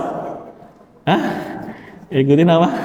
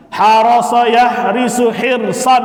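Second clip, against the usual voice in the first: recitation drill; 7 semitones higher, slower than usual. Shown as a demonstration. Teacher reciting the masdar gallery aloud, chanting. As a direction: recite each pattern clearly for the class.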